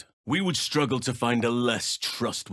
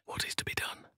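hushed, secretive question; stealthy whisper; 12 dB quieter, faster than usual. Acted. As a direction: ask it barely breathed.

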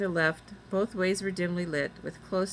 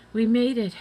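raised, louder, breathless relief; 4 semitones higher, 6 dB louder than usual. A narrator's reading. Girl just landed by the tower, breathless. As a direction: say it breathlessly on arrival. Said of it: "Annie said breathlessly".

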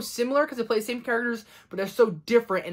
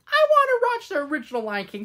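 high-pitched protest; acted exclamation; 9 semitones higher, 3 dB louder than usual. Reviewer quoting own reaction to the remake, high exclamation. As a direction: blurt it high, like a mock complaint.

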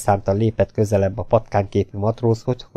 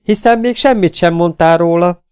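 high, raised protest; kiáltás; 9 semitones higher, 5 dB louder than usual. A narrator's reading. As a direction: protest loudly in a girlish high voice. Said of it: "kiáltott a lány". (felháborodva, magas hangon)

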